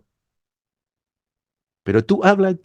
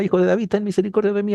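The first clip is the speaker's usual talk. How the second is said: higher, fast pleading cry; desperate crying out; 8 semitones higher, faster than usual. Retold or imitated. Preacher voicing the blind man crying out to Jesus, higher and fast. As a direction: beg for mercy, pitch climbing and rushed. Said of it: "él clamaba y gritaba".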